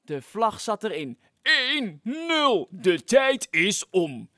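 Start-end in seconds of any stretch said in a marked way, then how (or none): 1.4-4.3 loud, very slow final call; drawn-out time's-up call; megaphone announcement. Acted. The megaphone man ends the contest, loud slow announcement. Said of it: "riep de meneer met de megafoon".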